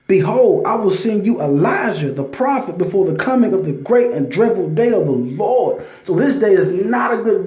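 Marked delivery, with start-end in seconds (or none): 0.1-5.8 louder, slowed, preaching cadence; fervent scripture reading. Acted. Reading Malachi aloud to his listeners, fervent loud preaching. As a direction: proclaim the verses like a sermon.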